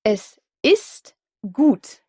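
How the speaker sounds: Es ist gut said slowly with a crisp final T; slow clear German. Shown as a demonstration. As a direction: model "Es ist gut" syllable by syllable, finishing the T of gut sharply. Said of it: "On doit donc bien entendre le T final".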